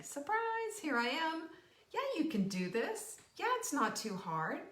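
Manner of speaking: high, bright "surprise!" popping out of nowhere; playful surprise. Pretend. Brain voiced surprising itself.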